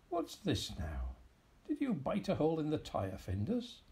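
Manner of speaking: put-on mildly cross old man scolding; complaining in a temper. A narrator's reading. Old man mildly scolds cat over flat tyre. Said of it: "complained in a temper".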